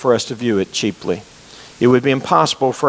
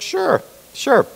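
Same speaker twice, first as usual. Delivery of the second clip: high, casual mock priest voice; breezy priest; 13 semitones higher than usual. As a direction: wave it off lightly as the kind bishop. Priest brushes off the police.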